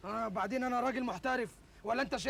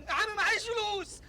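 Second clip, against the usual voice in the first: pleading; 10 semitones higher, 3 dB louder than usual. Acted. Caught boy pleads to shopkeeper.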